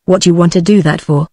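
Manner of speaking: ordinary delivery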